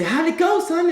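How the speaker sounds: very high-pitched parent voice; eager parent. Acted. Parents greeting son after his interview, high and eager. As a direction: pipe it up high, expectant.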